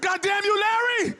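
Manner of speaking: high, loud exclaim; mock outrage with a laugh; hammed-up shout at a friend; laughing yell.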